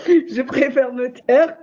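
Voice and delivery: slow, embarrassed laugh. Real, not acted. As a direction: laugh it off shyly. Laughs, decides to stop talking.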